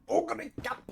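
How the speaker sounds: high, whispery "Ogre cap" with a forceful "cap"; strongly emphasized imitation. Showing how anime says "Ogre cap", mock-intense whisper. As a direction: mimic the anime call, airy and high, popping "cap". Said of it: "They actually do say it like that".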